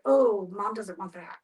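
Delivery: ordinary delivery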